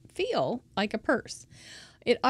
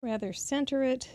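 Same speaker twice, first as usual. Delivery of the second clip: usual speaking voice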